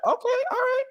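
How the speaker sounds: high squeaky mock voice; mock Bryan Danielson. Danielson reacting backstage, high mock voice. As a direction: squeak it out like a nervous onlooker.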